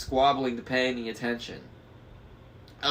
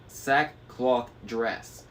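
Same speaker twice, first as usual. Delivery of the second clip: ordinary delivery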